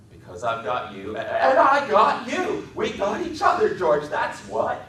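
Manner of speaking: high, loud laughing delight; Lenny laughing with joy. A narrator's reading. Lenny joyfully completing George's refrain, laughing. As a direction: finish the line beaming.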